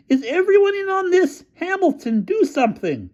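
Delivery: very high, panicked plea; appalled plea. A narrator's reading. Featherstone pleading with Hamilton, very high and panicked. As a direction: beg someone to act. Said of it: "shouted Featherstone, appalled".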